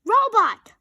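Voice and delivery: loud high call of a name; shout. A narrator's reading. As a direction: shout it across the room. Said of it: "shouted Rabbit".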